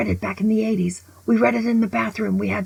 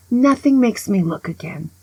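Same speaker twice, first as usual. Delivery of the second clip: usual speaking voice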